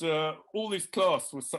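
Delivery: ordinary talk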